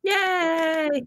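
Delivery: high gleeful yay; excited cheer. Real, not acted. Excited cheer at fight premise.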